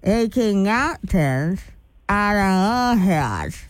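slow, garbled through a mouth guard; careful slow words with lips held apart. Real, not acted. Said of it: "I'll say it again".

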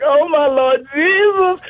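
high, slow overwhelmed gasp of a prayer; Jamie Foxx fainting. Retold or imitated. Fainting like Jamie Foxx at the sight of a gun, high swoon. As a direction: swoon like you're about to pass out. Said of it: "I felt like Jamie Foxx".

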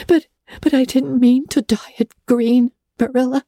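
put-on high, downcast girl's protest; protest, crestfallen; girl defending herself dejectedly. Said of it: "protested Anne dejectedly".

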